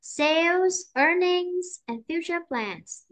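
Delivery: Sales and earnings held high, plans falling; slow, high listing, future plans coming down; list intonation. Shown as a demonstration. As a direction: lift each item, Sales, earnings, then drop on plans. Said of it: "phải cho nó lên".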